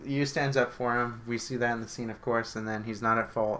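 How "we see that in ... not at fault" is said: ordinary talk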